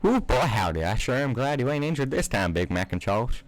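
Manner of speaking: hint of a Southern mare voice; Southern accent. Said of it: "I'm gonna stop this accent".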